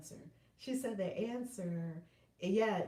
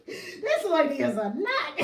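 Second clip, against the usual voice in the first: slow, louder words through laughter; laughing; 5 semitones higher, 9 dB louder, slower than usual. Real, not acted. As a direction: laugh as you call yourself a nut. Laughing at herself.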